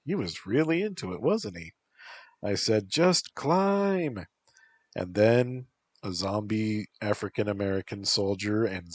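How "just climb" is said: urging. A narrator's reading. Narrator urging Zach.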